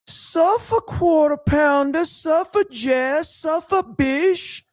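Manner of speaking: high, slow, wailing chant, stretching each suffer; PPP voice. Retold or imitated. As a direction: mimic PPP's high piercing note, dragged out. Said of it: "read this in the P P P voice".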